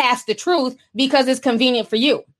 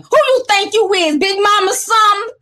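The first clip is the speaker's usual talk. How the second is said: high-pitched imitation of a Southern country accent; 8 semitones higher than usual. Host repeating Carl's clapback, hammed-up country accent. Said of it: "I can read his accent through his writing".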